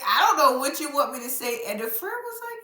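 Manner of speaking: ordinary talk, nothing marked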